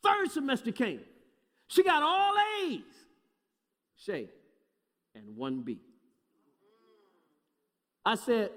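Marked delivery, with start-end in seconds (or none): 1.7-2.8 excited buildup. Real, not acted. Father telling congregation of daughter's third semester, excited buildup. 4.0-5.8 low, quiet comic letdown; deflated punchline. Real, not acted. Father admitting the one bad grade, deflated.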